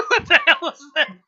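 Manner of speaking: high laughing disbelief. Real, not acted. Laughing at the fleeing bear.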